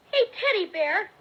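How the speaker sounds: loud call to the hidden bear. Acted. Boy calling loudly to the hiding bear.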